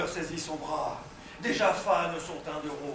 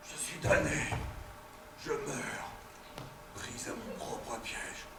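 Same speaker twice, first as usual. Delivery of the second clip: dying whisper; 3 semitones lower, 9 dB quieter than usual. Acted. Dying after being stabbed.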